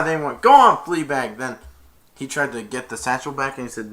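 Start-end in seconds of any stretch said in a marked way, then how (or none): 0.4-1.4 a louder, slightly higher call to the horse; loud urging. Acted. Guards urging Maximus on with a loud call. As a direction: spur the horse on with a shout.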